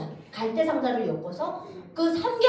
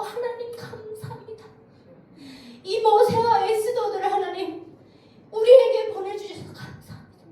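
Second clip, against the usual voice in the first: high-pitched imitation of fervent thanks, swelling louder; acted fervent prayer; 9 semitones higher, 4 dB louder than usual. Church members embracing newly arrived defectors, in mock fervent prayer. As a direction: mimic an overjoyed churchgoer praying, rising in volume.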